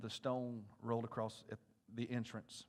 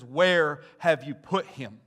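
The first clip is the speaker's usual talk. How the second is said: slow, loud, weighty question; Jesus demanding where Lazarus lies, slow and loud; stern question; 13 dB louder, slower than usual. Acted. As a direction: ask it slow and heavy. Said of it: "Jesus looks at him and he says".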